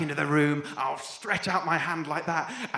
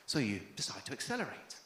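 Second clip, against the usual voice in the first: guided visualisation; 5 semitones lower than usual. Acted. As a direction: lull them into a calm daydream.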